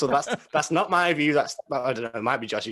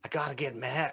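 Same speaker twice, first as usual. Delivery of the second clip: softly attempted Boston accent; quiet, half-hearted go at Boston; 11 dB quieter than usual. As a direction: try out a Boston accent under your voice. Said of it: "That was a Boston accent".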